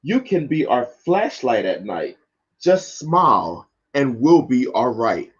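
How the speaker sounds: expressive picture-book read-aloud; lively children's book narration. Acted. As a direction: read it like story time for kids.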